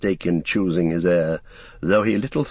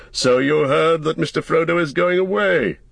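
lightly stern Gandalf; 9 semitones higher, 4 dB louder than usual. Acted. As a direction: press the eavesdropper, in character.

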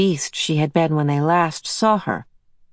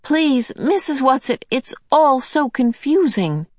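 put-on much higher, pleading girl's question; higher plaintive girl; 8 semitones higher than usual. A narrator's reading. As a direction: ask it helplessly, pleading. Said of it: "Meg asked plaintively".

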